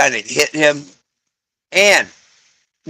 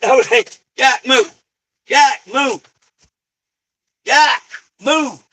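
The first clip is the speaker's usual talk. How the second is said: sharp raised commands to the dog; yelling at dog; 10 semitones higher than usual. Real, not acted. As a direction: order the dog out of the way. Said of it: "I'm yelling at my dog".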